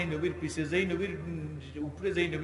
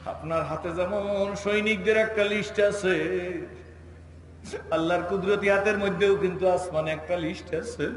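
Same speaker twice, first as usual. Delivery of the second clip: loud, higher-pitched melodic sermon; impassioned preaching; 4 semitones higher, 7 dB louder than usual. Acted. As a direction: build to a ringing proclamation. Gabriel's message preached loudly.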